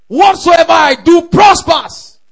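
high, slow, shouted declaration; triumphant pulpit proclamation; full-force preaching. Real, not acted. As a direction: stretch it out in a loud preacher's shout.

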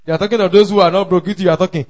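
ordinary talk, nothing marked

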